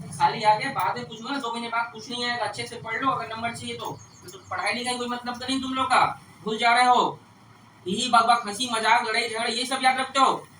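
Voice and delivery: loud, exasperated telling-off of students; real scolding. Real, not acted. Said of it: "आप लोग को डांट रहा हूँ".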